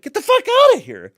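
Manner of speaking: loud, very high shout at a cat; exasperated yell. Retold or imitated. Retold scolding of his cat.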